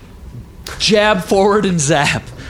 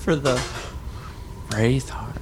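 whisper; 4 semitones lower, 10 dB quieter than usual. Pretend. Teasing echo, very soft.

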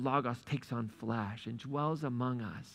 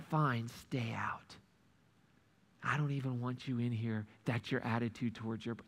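cold whisper; 5 semitones lower, 6 dB quieter than usual. Pretend. Father wrongly imagined rejecting older son, cold whisper. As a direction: hiss out a petty rejection.

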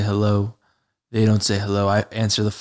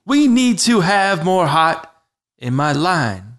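high, loud slam poet chant; raised, theatrical poetry voice; slam poetry delivery; 8 semitones higher, 4 dB louder than usual. Shown as a demonstration. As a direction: declaim it in measured slam beats. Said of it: "So you call and you say".

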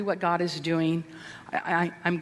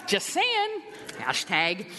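pitched way up, cheeky and teasing; sassy aside; slower than usual. Acted. Speaker teasing the congregation about overpriced coffee, sassy pitched-up aside. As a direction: toss it off with mock sass.

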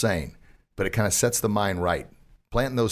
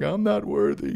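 mock unworthy whine; 11 semitones higher than usual. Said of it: "And I'm like".

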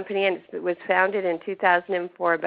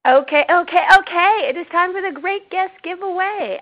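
excited announcer; 11 semitones higher, 5 dB louder than usual. Acted. Host opens giveaway segment.

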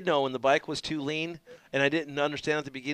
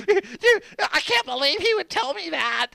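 high, louder whiny voice; imitating Sheik crying; 4 dB louder, slower than usual. Sheik complaining about Roger, high and teary. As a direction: whine it like a crybaby. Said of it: "He's like".

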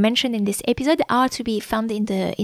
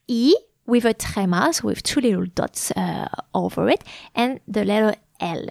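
ordinary talk

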